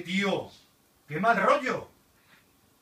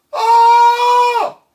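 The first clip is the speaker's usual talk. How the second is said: long high loud wail; screaming while falling; 17 dB louder than usual. Real, not acted. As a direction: yell as you drop. Virtual fall reaction.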